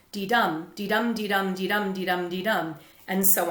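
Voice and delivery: dee-dum chanted with weak then strong beats; da-DUM rhythm, light on dee, hit on dum; iambic beat. Shown as a demonstration. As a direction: tap out the iamb, stress each dum. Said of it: "Five units of iambs per line".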